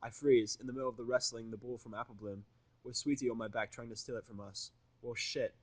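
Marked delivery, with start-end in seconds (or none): none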